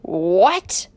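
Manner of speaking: loud outraged exclamation; explosive angry father; shouting. A narrator's reading. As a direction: shout it in indignant disbelief. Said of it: "shouted Adam".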